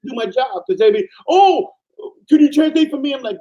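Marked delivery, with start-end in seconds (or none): 1.3-3.1 high whiny plea, much higher and louder; hammed-up nagging colleague; mock coworker. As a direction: mimic a pestering coworker asking a favor. Coworkers nagging him to translate.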